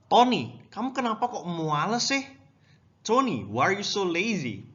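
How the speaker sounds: high, exasperated scolding of a brother; mock-mad sister. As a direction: act out a cross sister telling off her younger brother. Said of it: "the sister got really mad".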